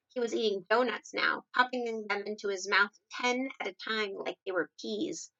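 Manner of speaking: ordinary delivery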